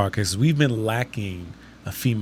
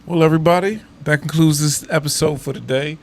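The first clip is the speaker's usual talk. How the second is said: upbeat loud sign-off; podcast outro; 3 semitones higher, 5 dB louder than usual. Acted. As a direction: wrap up the show brightly.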